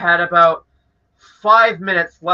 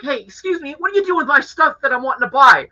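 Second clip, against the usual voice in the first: high-pitched indignant customer protest; acting out an annoyed Karen, pitched up; enraged Karen impression; 7 semitones higher than usual. Customer challenging the employee taking his stuff, annoyed. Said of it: "acting like an enraged Karen".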